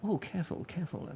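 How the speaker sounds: soft, high mock-fussy warning; a hint of a fussing waiter hovering close. Mimics fussing over guests' drinks.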